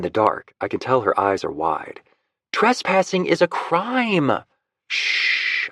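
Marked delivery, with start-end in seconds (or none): 2.5-4.4 higher, slow scandalised exclamation; woman protesting, slow and high; alarmed protest. Acted. 4.9-5.7 hushing the woman beside him; shushing. Acted. Covering her mouth to hush.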